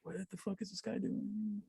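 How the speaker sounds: imitated muttering, high and very soft; slow, tiny aside under the breath; hushed disbelief. Bystander watching a dude flop at a party, hushed disbelief. Voices a bystander's private reaction.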